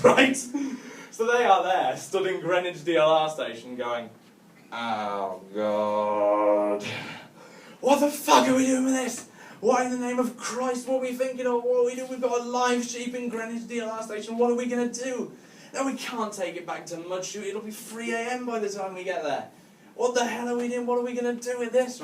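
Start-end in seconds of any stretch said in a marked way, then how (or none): 4.7-6.8 low, drawn-out mock groan of dread; acted groan of regret; sobering dismay. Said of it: "they have sobered up quite a lot". 7.8-22.0 high-pitched, hammed-up panic; acted frantic dismay, pitched well up; sobered-up panic. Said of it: "they have sobered up quite a lot".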